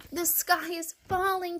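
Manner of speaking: high, slow, lightly puffed chick. A narrator's reading. Chick telling the fox, lightly puffing. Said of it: "puffed Chicken Little".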